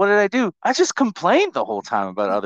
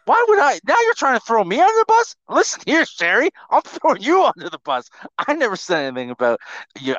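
very high, loud indignant protest; mock outrage; 11 semitones higher, 3 dB louder than usual. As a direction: protest in a squeaky, hammed-up fluster. Mock quarrel over being blamed.

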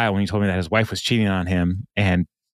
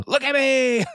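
high-pitched imitated yell; sudden, hammed-up high holler; mock yell. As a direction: yell it out like an attention-seeker. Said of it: "like, just yells".

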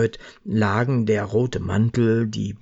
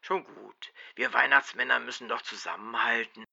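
soft reassurance; 3 semitones higher, 6 dB quieter than usual. Acted. Herbert reassures on phone.

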